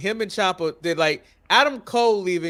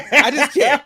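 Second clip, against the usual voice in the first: louder, higher disbelieving repeat; exasperated; 6 semitones higher, 7 dB louder than usual. Real, not acted. Fan still stunned by the food theft, louder and higher. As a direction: throw up your hands in disbelief. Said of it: "I still can't get over".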